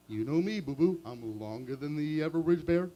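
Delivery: smug Yogi; Yogi Bear voice. Acted.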